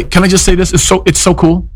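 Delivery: normal talking voice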